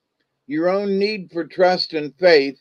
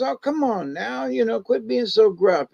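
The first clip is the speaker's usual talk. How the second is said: playful chiding; 8 semitones higher than usual. Acted. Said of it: "and she goes".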